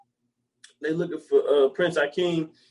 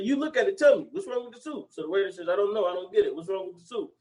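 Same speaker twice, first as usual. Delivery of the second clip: much higher, acting customer and waiter; high mock joke voices; 5 semitones higher than usual. Telling a joke to a friend, high mock voices. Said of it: "the best joke in the world".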